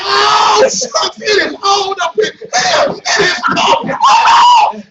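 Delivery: high, loud, ecstatic shouting; church frenzy. Retold or imitated. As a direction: lose yourself shouting in church. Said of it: "I be like".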